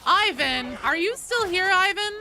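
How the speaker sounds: loud, high call across the room. Real, not acted. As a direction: call his name loudly to get attention. Calling out to a chat member.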